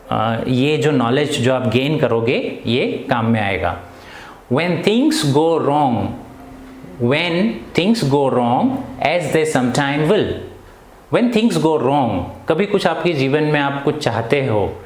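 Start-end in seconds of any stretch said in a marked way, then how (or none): 4.5-12.1 slow, drawn-out reading of each poem line; poem recitation. Acted. Teacher reading the poem aloud to children, slow and measured. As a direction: recite it slowly, word by word.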